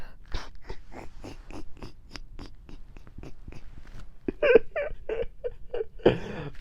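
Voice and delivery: long, high wheezing laugh; helpless laughter. Real, not acted. Storyteller cracking up at what he saw looking down, helpless laughter.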